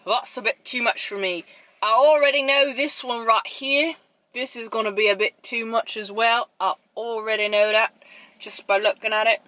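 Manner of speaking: mock British accent slipping into Borat; attempted British accent. Vlogger dreading the next sweet cookie, botched British accent. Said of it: "trying to do a British accent".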